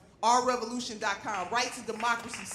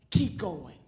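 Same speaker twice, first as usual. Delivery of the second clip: normal talking voice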